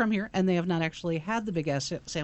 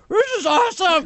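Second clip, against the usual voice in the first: loud excited exclaim; excited with mouthful; 11 semitones higher, 8 dB louder than usual. Retold or imitated. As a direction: shout it through a mouthful of food. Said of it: "I'm just going".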